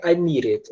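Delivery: "I need it" with the D flapped into an R; American-style linked "I need it", R-like D. Shown as a demonstration. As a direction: run "need it" together as "nee-rit". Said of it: "transformar esse D em som de R".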